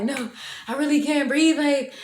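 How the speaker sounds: high-pitched acted panicky pleading; retold freak-out. High teenager begs friend to call mom, acted freak-out. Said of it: "I'm freaking out".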